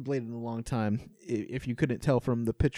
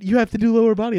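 imitating wife's frustration, higher and louder; frustrated wife; 7 semitones higher, 7 dB louder, faster than usual. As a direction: insist like an exasperated wife. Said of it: "Jamie's frustrated with me".

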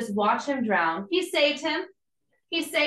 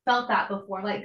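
ordinary talk, nothing marked

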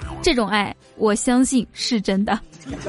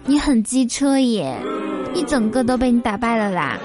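lilting Taiwanese accent. Shown as a demonstration. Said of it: "接下来我举几个例子哈".

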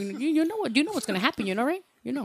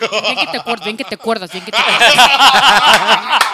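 repeated jeer that builds into laughing; real laughing taunt; teasing through laughter; 2 semitones lower, 11 dB louder than usual. Real, not acted. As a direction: tease loudly, breaking up laughing at the end. Teasing him, chanted over laughter.

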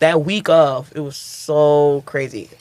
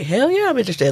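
higher-pitched, keen yes; eager acceptance; 7 semitones higher, faster than usual. Retold or imitated. Comic retelling her yes to a manager's offer, eager. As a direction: jump at the offer, pitch lifted.

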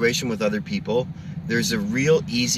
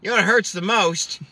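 Norm voice; 7 semitones higher, 4 dB louder than usual. Retold or imitated. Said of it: "He goes".

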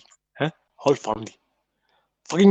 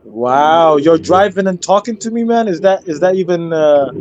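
mock amazement; 3 semitones higher, 7 dB louder than usual. Real, not acted. Amazed that the stranger is driving.

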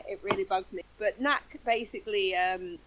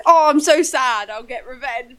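mock sad character; 10 dB louder, slower than usual. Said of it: "he's like".

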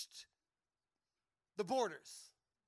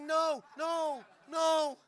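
desperate pleading; 4 semitones higher, 8 dB louder, much slower than usual. Retold or imitated. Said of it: "He's like".

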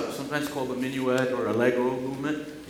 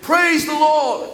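high, loud "Praise the Lord"; joyful proclamation; exclaimed praise; 11 semitones higher, 8 dB louder, slower than usual. Shown as a demonstration. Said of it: "all begin with".

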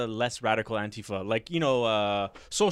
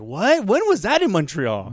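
mock disbelief; 7 semitones higher, 6 dB louder than usual. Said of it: "And I'm thinking, like".

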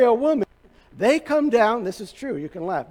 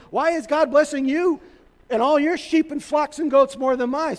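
imitating an indignant, higher-pitched complaint; mad father-in-law; 6 semitones higher than usual. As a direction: play a father-in-law grumbling in raised pitch. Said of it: "father-in-law was getting mad".

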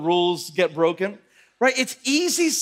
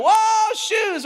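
high, loud whoop of triumph; mock celebrating; 4 dB louder than usual. [name] winning free shoes at bowling, exaggerated celebrating. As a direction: whoop it up like the winner. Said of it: "like, celebrating".

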